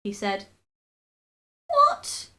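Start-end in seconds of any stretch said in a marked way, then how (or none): 1.7-2.3 very high gasped What. A narrator's reading. Terrified wife gasps a question, very high. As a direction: gasp it out high in terror. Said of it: "gasped Missus Twit".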